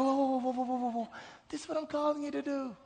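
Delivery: high, hushed soothing voice; imitated whisper. God settles the ranting believer, in a soft mock whisper. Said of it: "Just a little whisper".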